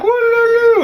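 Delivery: very high, loud ululating call; bum call. Retold or imitated. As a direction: hoot a rallying cry to the crowd. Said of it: "some type of bum call".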